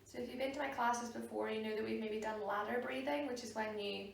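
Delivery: soft, unhurried meditation voice with long pauses; guided meditation. Real, not acted. Yoga teacher guiding a class to sit and breathe, soft meditation voice.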